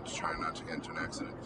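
fast hushed whisper, barely voiced; whispered to herself. Real, not acted. Host concentrating on not crashing while driving, whispering fast. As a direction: mutter it under your breath while focusing on the road.

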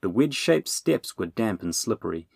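usual speaking voice

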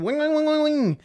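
high ringing gear whir; imitated spinning gears. Host showing caffeine getting gears going, high whirring noise. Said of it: "get the gears going".

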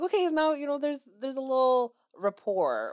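gushing to the interviewer in a high put-on voice; imitating a flirty gush. Self to interviewer, high gushing mock voice. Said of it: "I was like".

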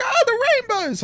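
put-on high, quick, childlike squeak of delight; tiny kid voice lighting up at the rainbow pack; little daughter voice. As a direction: play the excited little girl picking rainbows. Dad voices daughter choosing toys.

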